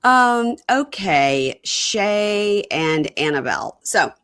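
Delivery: ordinary delivery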